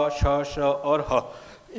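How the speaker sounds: श, श और ह slowly, each sound drawn out; breathy sibilant demo. Shown as a demonstration. Teacher demonstrating warm air, slow separated श, श और ह. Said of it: "इनके उच्चारण के समय आपको लगेगा कि एक गर्म हवा".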